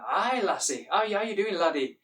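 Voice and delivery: hearty Scottish greeting accent; swooping Scottish accent. Shown as a demonstration. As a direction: welcome them in a playful Scottish accent. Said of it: "I can do the Scottish".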